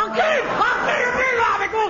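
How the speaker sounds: high, loud threatening yell; enraged shout. Acted. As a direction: roar it out, stretching the second one. Enraged son goes for heckling father.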